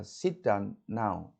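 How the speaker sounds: every word stressed, tune falling; falling order tone. Shown as a demonstration. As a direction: say "Sit down now" with every word hit and the pitch falling at the end. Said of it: "itu turun".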